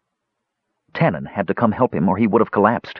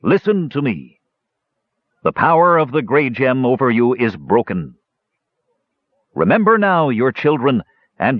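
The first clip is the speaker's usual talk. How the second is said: ringing, solemn god's proclamation; commanding oration, pitch raised on the appeals; stern command; 4 semitones higher than usual. A narrator's reading. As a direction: exhort them with ancient authority. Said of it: "in stern tones".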